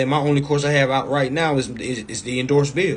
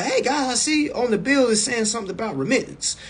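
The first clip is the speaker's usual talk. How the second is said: higher, innocent-sounding question to customer service; curious caller; 9 semitones higher than usual. Shown as a demonstration. Calling the bill company, a high mock-innocent question. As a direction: act like you just want to know. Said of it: "a curious question".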